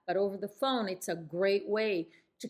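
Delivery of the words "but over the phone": ordinary talk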